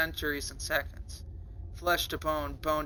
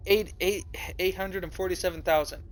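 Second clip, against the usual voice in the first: ordinary delivery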